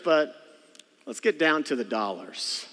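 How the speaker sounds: usual speaking voice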